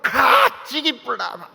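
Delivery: loud, explosive mock Gyeongsang outburst, first syllable stretched; Gyeongsang dialect threat. Preacher mimicking Gyeongsang churchgoers brawling, Gyeongsang dialect threat. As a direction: burst out like a fighting Gyeongsang local. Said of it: "경상도 사람들은 경상도 말로 싸우고".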